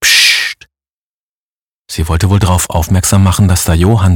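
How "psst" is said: long voiceless "psst"; shushing. A narrator's reading. The mother warning Brugger that Johann is there, shushing. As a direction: shush with pure sustained hiss. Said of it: "Johanns Mutter machte Psst".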